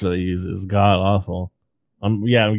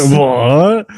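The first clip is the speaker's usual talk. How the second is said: surprised; 5 semitones higher, 6 dB louder than usual. Retold or imitated. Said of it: "He's like".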